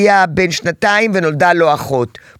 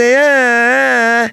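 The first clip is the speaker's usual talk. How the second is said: slow, drawn-out mock toddler whine, higher; imitated whining; 5 semitones higher, 3 dB louder, much slower than usual. Mother imitates the whining sound her son makes, slow mock whimper. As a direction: drag out a fake baby whimper. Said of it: "כשאתה תעשה".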